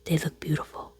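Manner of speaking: hushed retelling of the dentist's words; quiet mock dentist voice; whispered quote. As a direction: whisper the compliment back. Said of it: "She said".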